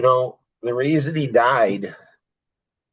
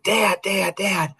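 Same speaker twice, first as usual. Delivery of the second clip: retold excited boy, higher pitch; excited child; 8 semitones higher, slower than usual. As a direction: call out like an eager kid running up. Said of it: "He's all excited".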